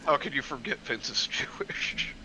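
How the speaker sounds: usual speaking voice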